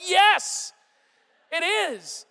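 put-on sulky nine-year-old digging in; whiny kid. Nine-year-old son answering his dad about clipping down, whiny.

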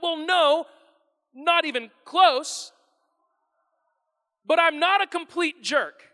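hammed-up shrug of a so-so person; mock sheepish admission. Pastor mimicking someone who claims to be good, sheepish.

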